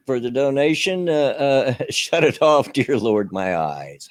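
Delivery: normal talking voice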